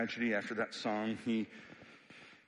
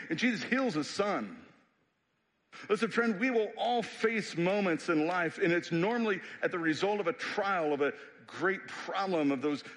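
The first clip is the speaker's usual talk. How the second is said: preaching; 4 semitones higher than usual. Acted. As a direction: preach it out to the church with growing fire. Preaching at full force.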